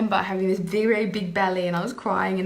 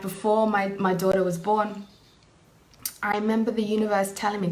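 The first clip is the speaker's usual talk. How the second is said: ordinary delivery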